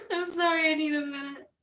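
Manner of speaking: laughing to tears, catching breath; can barely get it out for laughing; real helpless giggles. Real, not acted. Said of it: "I'm losing my mind".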